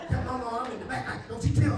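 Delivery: loud laughing urgent plea. Retold or imitated. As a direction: beg her, half laughing, not to tell. Hiding from mama, urging sister.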